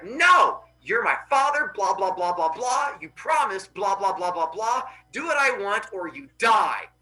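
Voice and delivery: mock tantrum with blahs filling in; yelling in argument. Son argues with his father Helios, hammed-up yelling. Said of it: "keep yelling at each other back and forth".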